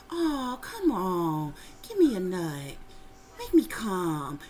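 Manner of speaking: whiny begging voice with a catch, then low and slow; pleading man. Retold or imitated. Dream man begging her for sex, whiny plea.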